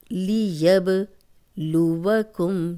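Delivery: لیبلوکم with a bouncing qalqala on ب; slow recited demo. Shown as a demonstration. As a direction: recite لیبلوکم slowly, let the ب echo. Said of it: "اب با پہ قلقلہ ہے". (لیبلوکم، با پر قلقلہ کے ساتھ)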